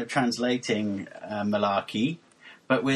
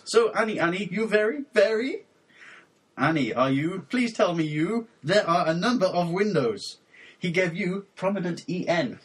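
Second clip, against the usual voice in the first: raised, deliberate reading with questioning lifts; deadpan lyric recitation; 5 semitones higher than usual. Acted. Host reading mangled pop lyrics for co-host to guess, raised deliberate recitation. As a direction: recite it solemnly, phrase by stilted phrase.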